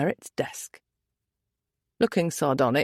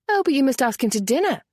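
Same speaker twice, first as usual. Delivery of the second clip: quick, higher eager exclamation; exclaiming; 7 semitones higher, faster than usual. A narrator's reading. Merritt urging her husband to invite the stranger, quick eager voice. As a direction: burst out warmly urging the invitation. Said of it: "Merritt had exclaimed".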